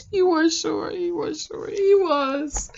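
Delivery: soft, amused aside through laughter; real chuckle under the words; laughing. Real, not acted. Laughs confirming he was short.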